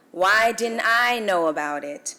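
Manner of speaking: ordinary talk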